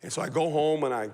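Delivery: normal talking voice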